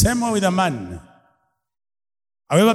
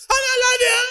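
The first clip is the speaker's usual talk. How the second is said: tiny husband voice; much faster than usual. Retold or imitated. Mocking a husband with a tiny voice to his wife. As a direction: squeak it out like a tiny-voiced man. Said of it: "husband with a very tiny voice".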